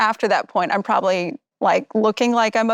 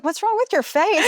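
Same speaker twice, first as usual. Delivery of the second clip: mock-quizzical, much higher and rising; imitating the trainer; 10 semitones higher than usual. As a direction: play the trainer noticing her face, lightly. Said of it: "And then you're like".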